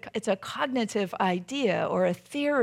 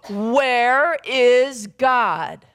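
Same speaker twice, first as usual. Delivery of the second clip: very slow, loud pastor's thunder; imposing pastor at full force; big booming voice; 4 semitones higher, 8 dB louder, much slower than usual. Acted. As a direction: boom each word out, long and raised. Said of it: "in his big booming voice".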